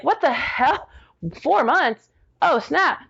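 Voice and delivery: high-pitched, incredulous reaction; retold disbelief. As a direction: act out being floored by bad news. Her retold shocked reaction to news.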